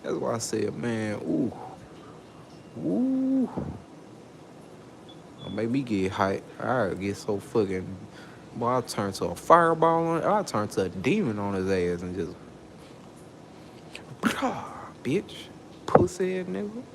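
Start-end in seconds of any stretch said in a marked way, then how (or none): 2.8-3.5 a sudden high hooting ooh. Real, not acted. Worked up, hyping himself. 14.2-16.8 high-pitched scornful jeer; angry insult. Real, not acted. Ranting at the man on payroll.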